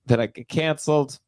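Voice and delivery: normal talking voice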